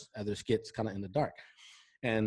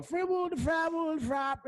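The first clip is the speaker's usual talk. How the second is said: much higher, slow, drawn-out mock Cosby babble; Bill Cosby impression; slower than usual. Friend imitating Ari Spears doing Cosby, high slow silly babble. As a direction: ham up the Cosby nonsense words, way up high. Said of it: "doing the whole Bill Cosby thing".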